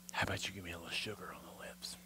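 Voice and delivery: fast, soft whispered pickup line; whispered come-on. Retold or imitated. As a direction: whisper it close to her ear. Boy asking girl for a kiss.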